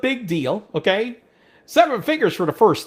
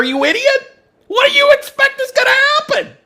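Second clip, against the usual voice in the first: loud, much higher, worked-up telling; heated rant; 5 dB louder than usual. Retold or imitated. Ranting about coworkers leaving a mess, loud and heated. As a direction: build it up, fired up.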